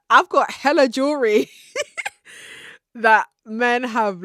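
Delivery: half-laughing boast. Real, not acted. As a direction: giggle it out while bragging. Laughing while bragging.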